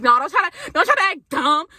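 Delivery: high, loud don't try to act dumb; exasperated. Retold or imitated. Scolding the frat, exasperated and loud. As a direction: call his bluff sharply. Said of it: "And like".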